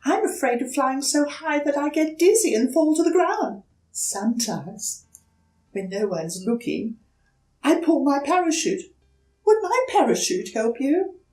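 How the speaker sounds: high, fast twittering voice; chirpy little bird. Acted. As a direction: rattle it off nervously. The bird confides its fear.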